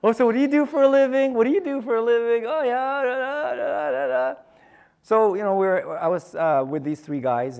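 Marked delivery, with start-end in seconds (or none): 0.0-4.4 fast, high, breezy chatter; breezy golf-course chatter; chatty small talk. Retold or imitated. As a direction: rattle off eager small talk. Acting out chatty golfer small talk.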